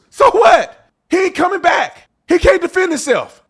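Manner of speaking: real fury boiling over; angry shout. Real, not acted. Host shouting at online critics, furious. Heated defense of dead friend.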